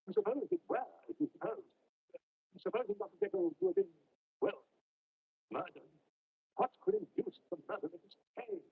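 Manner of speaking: high, urgent reasoning; heated argument. A narrator's reading. Lawyer and butler arguing over the murder, heated. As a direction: argue it out in a strained high pitch.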